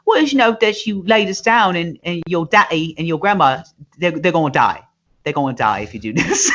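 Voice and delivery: put-on high, ranting English accent; mock scolding English lady. English lady warning worker about his family, high mock English rant. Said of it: "she goes in on him".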